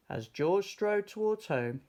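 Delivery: usual speaking voice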